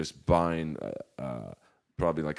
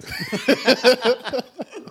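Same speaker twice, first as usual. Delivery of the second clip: a loud high burst of laughter; real big laugh; 10 dB louder than usual. Real, not acted. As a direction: break into a big laugh mid-story. Laughs mid-quote.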